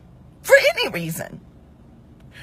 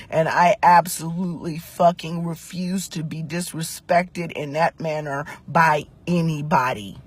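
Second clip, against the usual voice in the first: low, soft, controlled anger; hushed, low, deliberate resolve; grave declaration; 7 semitones lower, 6 dB quieter than usual. Real, not acted. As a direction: state it quietly and firmly, word by word. Laying down her position firmly.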